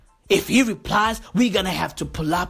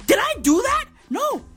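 high squeaky rant; 12 semitones higher than usual. Acted. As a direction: squeal it out like a hype bro.

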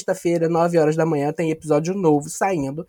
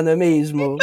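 usual speaking voice

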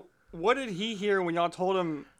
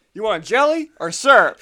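loud, higher-pitched joke captor; mock menacing question; 5 semitones higher, 11 dB louder than usual. Captor greets knocked-out passenger waking up, loud mock menace. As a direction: belt out the question in hammed-up menace.

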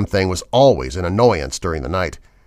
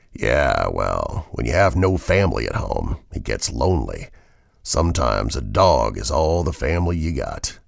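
menacing trucker; 8 semitones lower than usual. A narrator's reading. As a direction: brood over it darkly. Villain voiced in story.

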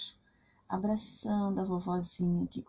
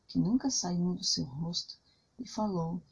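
ordinary delivery

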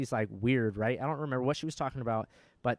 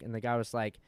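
normal talking voice

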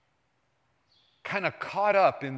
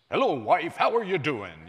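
louder announcer-style greeting; sportscaster voice; 6 dB louder than usual. Retold or imitated. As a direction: greet the wife like a broadcaster. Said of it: "the sportscaster person".

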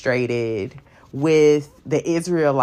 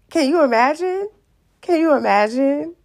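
incredulous; 12 semitones higher, 3 dB louder than usual. Real, not acted. Incredulous exclamation, high pitch.

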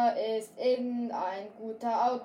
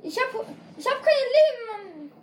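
high, loud panicked wail; panic, then a whimper; panicking gamer; 10 semitones higher, 4 dB louder, faster than usual. Real, not acted. Low health in fight.

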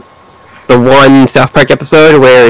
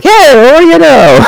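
pleasantly surprised. Retold or imitated. Jack's pleased reaction.

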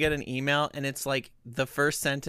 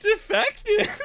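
mock echo. Mocking echo of a title.

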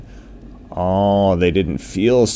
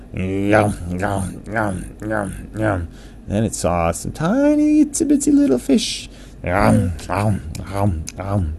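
happy eating sounds; 2 semitones higher than usual. A narrator's reading. Whale eating krill and fish.

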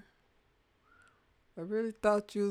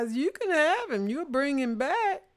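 joking mother; 9 semitones higher, 6 dB louder than usual. Retold or imitated. Mother lending out her son.

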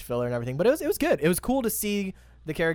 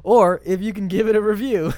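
put-on Texas accent; 3 semitones higher than usual. Podcast host reads a fan letter in a mock Texas accent. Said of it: "read this one in my Texas accent".

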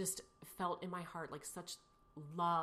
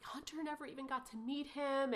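higher-pitched imitation of crying child; retold child's crying; 7 semitones higher than usual. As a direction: lightly mimic a child's tearful protest. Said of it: "And he's crying".

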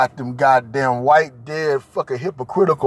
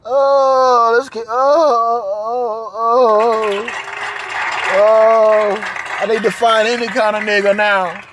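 high, louder, wailing mock panic; emotional woman; 7 semitones higher, 4 dB louder, much slower than usual. Mocking a woman panicking over finding a man, high wail. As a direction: ham up a frantic desperate woman. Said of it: "she's so emotional and shit".

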